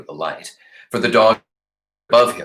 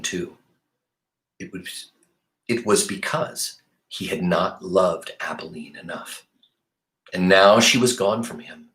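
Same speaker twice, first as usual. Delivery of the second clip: ordinary delivery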